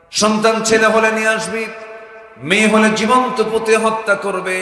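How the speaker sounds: high, loud chanted command; full-force preaching. Acted. Husband ordering his wife about the unborn child, full-force preaching.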